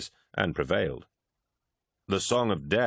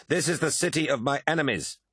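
pitched-up, gloating defiance; mocking laughter in words; 8 semitones higher than usual. A narrator's reading. Lucius taunts Prahl in battle, high gloating jeer. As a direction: crow it scornfully at an opponent. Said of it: "laughed Lucius".

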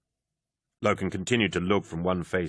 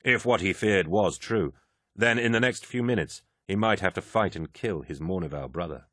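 ordinary delivery, nothing marked

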